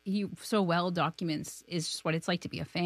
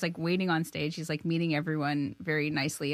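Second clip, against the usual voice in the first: ordinary talk, nothing marked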